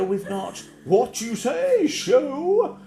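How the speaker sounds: very slow, drawn-out showman voice; mocking a slow, grand show intro; mocking pompous host. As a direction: stretch every word like a grand presenter. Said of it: "And he used to".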